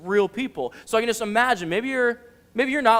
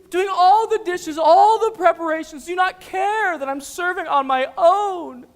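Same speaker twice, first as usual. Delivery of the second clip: high, slow, dramatic complaint, building louder; frustrated pleading; 9 semitones higher, 5 dB louder than usual. Acted. Martha complaining to Jesus she serves alone, exasperated plea. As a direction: lean hard on every grievance. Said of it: "how frustrated she is".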